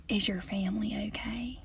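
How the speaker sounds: unvoiced, hushed worried question; concerned whisper. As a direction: whisper it like bad news. Customers asking about fires.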